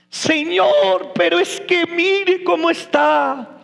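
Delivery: high, whiny plea to the Lord; mock pleading. Acts out doubting complaint to God. (queja suplicante fingida)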